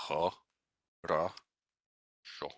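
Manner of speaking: "H, Ra, Sho" syllable by syllable, unstressed vowels reduced to uh and ah; "H, Ra, Sho" in separated syllables, only the last O full. Shown as a demonstration. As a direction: sound out "H, Ra, Sho" as huh, rah, SHO. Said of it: "instead of being fully pronounced as O's, they sound like".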